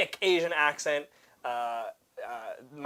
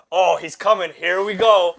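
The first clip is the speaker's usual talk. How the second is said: loud, raised, building hype; excited anticipation, imitated; 4 semitones higher, 11 dB louder, slower than usual. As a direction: play a thrilled spectator. Retold coworker watching footage, anticipating.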